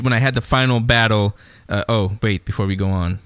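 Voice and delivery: ordinary talk, nothing marked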